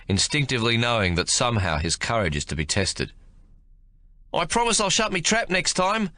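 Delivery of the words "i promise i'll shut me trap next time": put-on high, boyish voice of a nervous young soldier; a scared recruit promising to behave; young private's voice.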